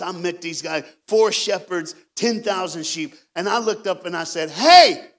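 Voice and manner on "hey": loud, high-pitched holler; sudden raised call across a crowd. Retold or imitated. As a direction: shout to get the shepherds' attention. Retells calling out to the shepherds.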